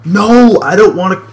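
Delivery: loud, high, frustrated wail of refusal; mad protest. Real, not acted. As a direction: cry out in real gamer rage. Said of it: "I'm so mad right now".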